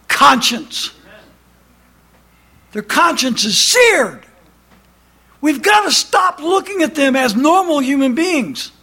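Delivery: high, loud pulpit delivery; impassioned preaching. Acted. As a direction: drive every line home to the pews. Preaching to the congregation.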